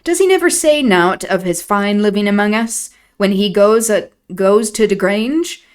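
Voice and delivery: put-on broad old Yorkshire servant voice; Yorkshire dialect. Nellie voicing old Joseph in thick dialect. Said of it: "Nellie doing an impression of Joseph".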